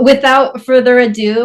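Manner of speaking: ordinary talk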